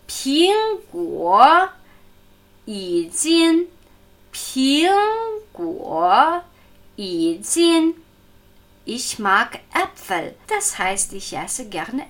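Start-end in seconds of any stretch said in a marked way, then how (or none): 0.1-7.9 Ping Guo, yi Jin with careful, exaggerated tones; teacher's Mandarin demo, Ping rising high and Jin held level; model Mandarin pronunciation. Shown as a demonstration. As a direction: show how Ping Guo, yi Jin sounds, syllable by syllable. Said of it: "Ein Sinn Äpfel wird so klingen".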